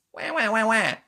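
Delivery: high, loud "wah" bawling; rapid put-on baby cries; mock baby wail. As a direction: squall like an infant, hammed-up. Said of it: "I'm God, baby".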